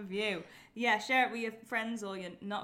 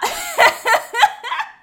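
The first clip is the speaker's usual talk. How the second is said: high shrieking laugh; 14 dB louder than usual. Real, not acted. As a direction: burst of squealing laughter. Loud laugh.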